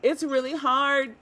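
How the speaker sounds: loud, high laughing complaint. Real, not acted. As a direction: laugh through the struggle. Struggling not to watch leak.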